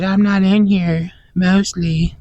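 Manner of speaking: high, slow, loud little monster voice; rabbit monster voice. Retold or imitated. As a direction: hide as a cheeky creature, squeaking high. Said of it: "The rabbit monster goes".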